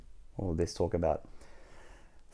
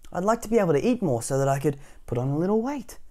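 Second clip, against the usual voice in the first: higher, light character voice; Mister Skinny, wishful patient; 8 semitones higher, 4 dB louder than usual. A narrator's reading. Mister Skinny telling the doctor, high wishful voice. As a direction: plead lightly, lifting at the end.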